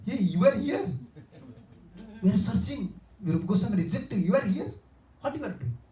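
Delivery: elder finds the rejected disciple, surprised and high. Retold or imitated. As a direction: react with surprise at finding him. Sanatana surprised to find Jiva.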